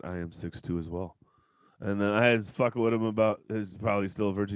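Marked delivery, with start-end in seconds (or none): 0.0-1.2 calm quiet voice. Retold or imitated. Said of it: "He's like".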